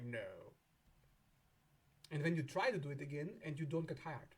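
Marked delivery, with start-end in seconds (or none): 0.0-0.5 low, flat, soft refusal; stern Saturn. Pretend. Saturn refusing the client, low and final. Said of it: "Saturn's like".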